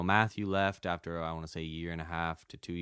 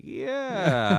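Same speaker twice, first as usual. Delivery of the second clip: mock unsure yeah; 10 semitones higher, 8 dB louder than usual. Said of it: "And I was like".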